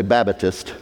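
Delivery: "Babbatist" with an extra middle syllable; Southern pronunciation. Retold or imitated. Preacher imitating down-south folks, "Babbatist" with an added syllable. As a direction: say "Babbatist" in three syllables, BAB-uh-tist. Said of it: "if they're from down south".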